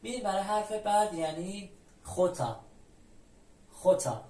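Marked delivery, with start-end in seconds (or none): 2.0-2.6 خوتا opening on a throaty kh. Shown as a demonstration. 3.7-4.2 خوتا opening on a throaty kh. Shown as a demonstration.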